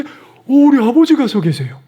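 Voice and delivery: like spotting your late father in the mirror; overjoyed exclamation; joyful surprise. Real, not acted. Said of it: "얼마나 반갑든지요".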